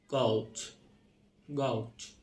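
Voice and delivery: গলচ with a broad "o" vowel; British-style pronunciation. Shown as a demonstration. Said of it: "British". (British উচ্চারণে গলচ)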